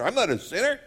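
imitating an indignant listener, rising high; offended listener. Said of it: "people are offended when they're called a sinner".

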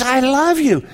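high, ringing I love you; joyful proclamation. Acted. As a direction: proclaim it with delight. Contrasting Lord's words, raised voice.

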